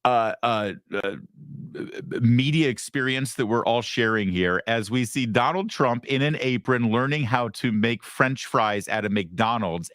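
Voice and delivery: ordinary delivery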